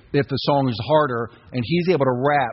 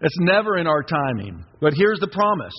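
normal talking voice

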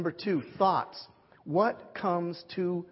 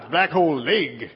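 laughing; 14 dB louder than usual. Real, not acted. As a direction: laugh loudly at your own grab for glory. Mocking himself grabbing glory.

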